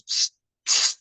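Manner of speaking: a quick unvoiced psst, psst; sneaky stage whisper to a colleague. Trying to get Steve's attention quietly.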